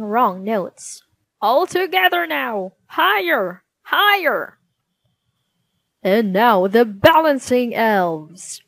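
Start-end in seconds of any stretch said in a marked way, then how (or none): 1.4-4.6 high, loud, urging the singers upward; choir leader. Acted. Goblin leader urges the choir to sing higher, high and loud. 6.0-8.6 announcing king. Acted. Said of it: "said the king".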